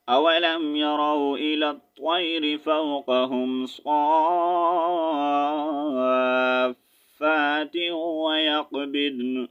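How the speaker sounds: Quran recitation. Acted. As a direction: chant the verse like a qari. Melodic Quran recitation of verse.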